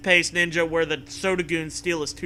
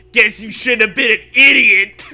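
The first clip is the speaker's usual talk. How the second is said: loud, higher laughing taunt; mocking coach; 5 semitones higher, 12 dB louder than usual. Coach taunting the beheaded player, laughing sneer.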